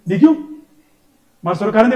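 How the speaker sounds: high, loud mock grandmother; grandmother scolding. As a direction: complain like an outraged granny. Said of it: "मेरी दादी कहती". (दादी की नकल, ज़ोर से)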